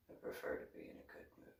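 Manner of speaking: breathy whisper, fast and hushed; whispered repeat. Shown as a demonstration. Repeating her preference to Coyote as a whisper. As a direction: whisper it again quickly.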